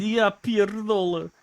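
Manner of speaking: drawn-out Polish curse. Shown as a demonstration. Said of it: "You just yell".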